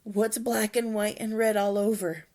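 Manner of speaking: loud, playful riddle; joke-telling voice. Acted. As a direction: tease it like a riddle. Hiccup tells a joke.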